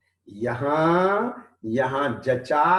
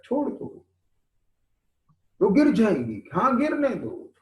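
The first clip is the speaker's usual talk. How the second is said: raised, animated acted voices; acted exchange; 8 semitones higher than usual. Preacher acting out a kite flyer told to let go, raised and animated. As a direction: play out the kite quarrel brightly.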